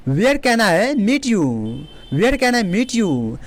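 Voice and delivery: very slow, careful words; slow classroom demo; slow teaching delivery. Shown as a demonstration. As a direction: model it slowly. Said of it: "teacher models question".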